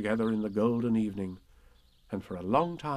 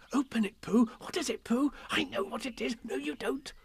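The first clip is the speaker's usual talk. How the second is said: put-on eager children's voices; 12 semitones higher than usual. Everyone crowding Pooh with remarks.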